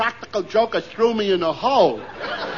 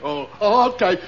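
ordinary delivery

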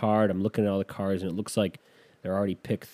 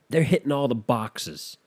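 usual speaking voice

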